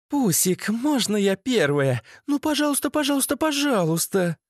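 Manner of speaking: put-on very high girlish wheedle, climbing; begging girlfriend. A narrator's reading. Masha begging Pusik to go first, high-pitched wheedle. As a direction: plead like an excited little girl.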